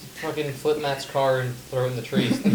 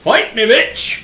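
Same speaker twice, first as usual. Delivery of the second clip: loud, slow, high taunt; mock challenge; 8 semitones higher, 12 dB louder, much slower than usual. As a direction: bellow it like a mock fight.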